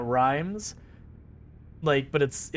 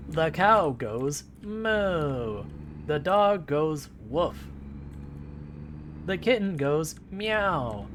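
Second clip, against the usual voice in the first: put-on slow sing-song kids' teaching voice; 3 semitones higher, much slower than usual. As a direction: sound each animal out slowly for a toddler. Mimics a child's learning rhyme.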